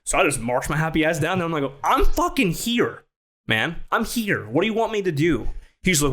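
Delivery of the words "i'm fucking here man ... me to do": high, worked-up retold protest; exasperated confrontation. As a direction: announce yourself indignantly, pitch jumping way up. Retold confrontation after marching down.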